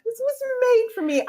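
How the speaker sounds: high tearful squeal. Retold or imitated. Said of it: "I legit cried".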